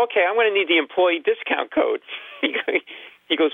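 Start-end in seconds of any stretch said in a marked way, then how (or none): 0.0-2.0 higher-pitched sarcastic request; retold sarcasm. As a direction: make a mock-earnest demand of the cashier. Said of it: "So then I get really sarcastic".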